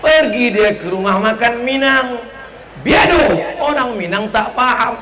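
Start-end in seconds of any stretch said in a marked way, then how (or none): 2.8-3.2 biado shouted much higher and louder; loud Batak greeting. Retold or imitated. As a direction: bellow biado like a mountain villager over the wind. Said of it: "Suaranya keras".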